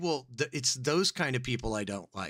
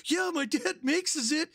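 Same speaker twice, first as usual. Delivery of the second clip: retold quote. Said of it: "He's like".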